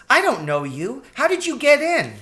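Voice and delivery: put-on high, loud startled child; alarmed, pitch shooting up; alarmed girl rat. A narrator's reading. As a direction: challenge the intruder in a piping young voice. Alarmed child rat confronts stranger.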